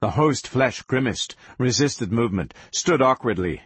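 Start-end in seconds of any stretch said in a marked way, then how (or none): none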